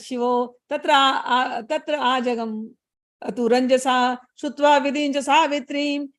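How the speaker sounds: slow, measured scriptural reciting; Sanskrit verse recitation. Acted. Teacher reading scripture to listeners, slow recitation.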